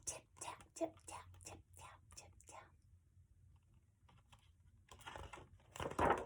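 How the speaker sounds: whispered, evenly spaced taps; tiny tappy beetle. Acted. Reader shows children a beetle's hello, whispered taps. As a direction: tick it out in a small whisper. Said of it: "is tiny and tappy".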